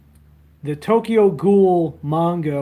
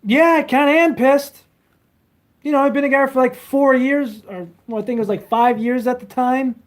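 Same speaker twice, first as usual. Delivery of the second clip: much higher mock girlfriend voice; imitating girlfriend; 8 semitones higher, 4 dB louder than usual. Girlfriend scolding him about smoking, imitated much higher. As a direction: squeak out her annoyed complaint. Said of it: "She says".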